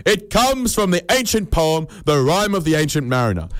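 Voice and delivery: high, emphatic proclamation; very loud announcement. Shown as a demonstration. Answering people who ask about the saying, loudly proclaimed. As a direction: proclaim it with the pitch pushed right up. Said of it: "very loudly".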